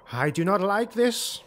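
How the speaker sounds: uneasy warrior objecting, voiced up; lightly voiced retainer. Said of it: "Silar Thornblood said".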